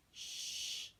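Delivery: soft unvoiced hush; whispered shush. Baby hushing so sleeping Daddy is not woken. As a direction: breathe the shush out softly.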